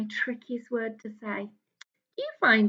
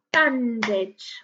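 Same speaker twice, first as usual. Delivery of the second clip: "Bandage" clapped in two beats; two clear clapped beats; clapped syllables; 4 dB louder than usual. Shown as a demonstration. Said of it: "let's clap it out".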